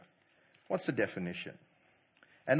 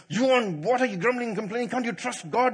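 angry Moses; 9 semitones higher, 9 dB louder than usual. Retold or imitated. Moses scolding the grumbling people.

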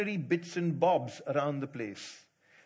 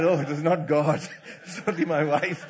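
louder, laughing punchline; 7 dB louder than usual. Retold or imitated. Preacher joking to the congregation, laughing. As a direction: crack up on the punchline. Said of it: "I said".

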